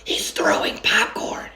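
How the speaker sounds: hushed whisper in a dark cinema; unvoiced whisper to his father. A narrator's reading. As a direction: breathe it so nobody hears. Whispering in a movie theater.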